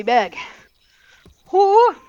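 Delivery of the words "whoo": loud high whoop; whoo cheer. Real, not acted. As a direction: let out a big whoop. Cheering while lifting heavy bag.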